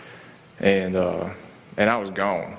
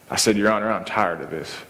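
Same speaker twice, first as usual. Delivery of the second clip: ordinary delivery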